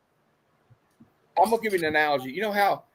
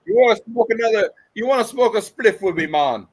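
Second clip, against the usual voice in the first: a hammed-up Jamaican accent, higher and louder; mock Jamaican accent; 6 semitones higher, 5 dB louder than usual. Showing up the movie's accent, hammed-up Jamaican accent. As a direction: lay on a cartoon Jamaican accent for laughs. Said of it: "I could do a better Jamaican accent than this".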